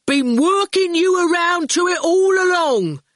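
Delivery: high, loud gloating; triumphant shout. A narrator's reading. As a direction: crow that the plan worked. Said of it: "Erskine shouted".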